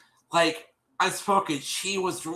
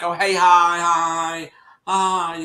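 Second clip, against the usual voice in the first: high, drawn-out mock hello; mimicked greeting; 4 semitones higher, 6 dB louder, much slower than usual. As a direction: imitate her sing-out greeting, pitched up. Mimics Ashley's greeting on entering.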